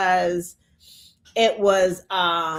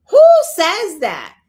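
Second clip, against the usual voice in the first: much louder, higher outraged repeat; outraged shout; 6 semitones higher, 10 dB louder than usual. Real, not acted. Ranting vlogger repeats the question at viewers, outraged shout. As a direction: yell the question back in disbelief.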